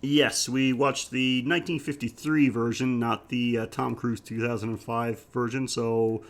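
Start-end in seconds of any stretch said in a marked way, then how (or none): none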